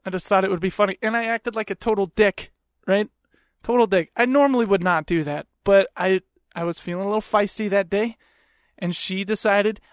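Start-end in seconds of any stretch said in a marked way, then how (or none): none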